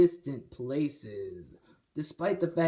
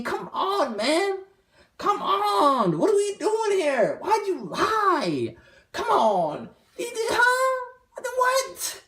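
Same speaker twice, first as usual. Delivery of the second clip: mock crying; 4 dB louder, slower than usual. Mock outrage at fake news.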